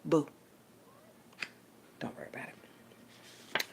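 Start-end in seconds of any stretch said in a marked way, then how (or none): 2.0-3.6 soft, slow whisper; hushed dismissive whisper. Real, not acted. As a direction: breathe it out secretly.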